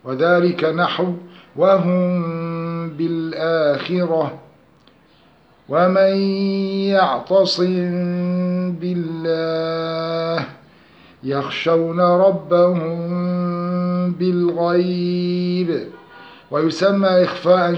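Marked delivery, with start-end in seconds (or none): 1.6-4.4 slow chant, وهم held in a nasal hum before بالآخرة; tajweed concealed meem. Shown as a demonstration. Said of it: "أخفيت الميم بغنة". 5.7-10.4 very slow chant, humming the m of يعتصم into بالله; tajweed concealed meem. Shown as a demonstration. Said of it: "أخفيت الميم بغنة". 11.2-15.9 slow recitation, ربهم held in a nasal hum before بالغيب; tajweed concealed meem. Shown as a demonstration. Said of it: "أخفيت الميم بغنة".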